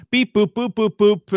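high playful beep boop sounds; mock beeping noises. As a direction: chirp it like a robot. Imitating quick scoring drive.

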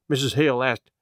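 usual speaking voice

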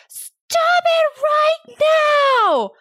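high, loud, slow shout; retold yell. Mom reenacting yelling at her son, slow loud shout. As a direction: bark it at a misbehaving son. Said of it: "And I just yelled".

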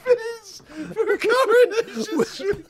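high, slow words squeezed out through tears of laughter; helpless laughter. Real, not acted. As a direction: laugh till you cry. Laughing so hard he cries.

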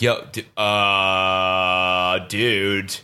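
slow, drawn-out dude-bro disbelief; lightly voiced bewildered bro; hammed-up freaked-out dude; mock bro voice. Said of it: "been like".

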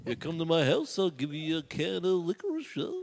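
put-on wobbly, mangled British accent; lightly voiced detective losing the accent; botched British detective. Comic attempting a famous sleuth, muddled British accent. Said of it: "How does Sherlock talk".

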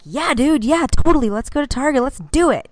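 hyped-up agreeing like a fun mom on caffeine; overeager fun mom. Retold or imitated. Mom to her son, gushing yes in a hyped voice. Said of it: "And I'm like".

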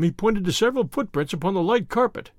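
usual speaking voice

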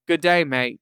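"good day, mate" in a broad Aussie accent; Australian accent. Shown as a demonstration. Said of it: "which ends up sounding like".

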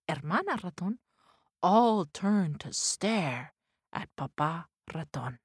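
plain reading voice

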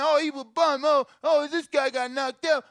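mocking critics. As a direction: jeer like a smug critic. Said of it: "you calling".

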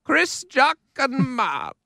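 lightly voiced Queen Elizabeth; slow, lofty royal voice; Queen impression. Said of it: "our best impressions of the Queen".

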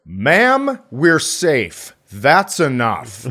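a passenger telling the wailing woman to stop; firm man. Retold or imitated. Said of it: "some guy goes".

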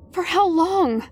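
ordinary delivery

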